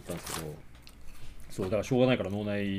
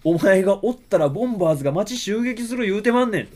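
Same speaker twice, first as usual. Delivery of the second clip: higher, louder, slow Kansai-dialect policeman scolding the hero; Kansai dialect cop; 6 semitones higher, 7 dB louder, slower than usual. Retold or imitated. Policeman in Kansai dialect orders the hero out of town. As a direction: bark it like an Osaka beat cop telling him off. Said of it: "関西弁だ。".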